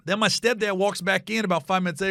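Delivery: ordinary talk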